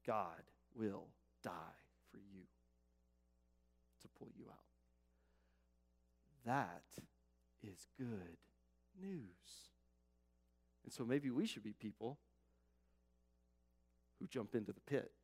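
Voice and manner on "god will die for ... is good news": slow, near-whispered, low delivery; soft, weighty pauses; hushed solemnity. Real, not acted. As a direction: let it sink almost to a whisper. Solemn climax of sermon.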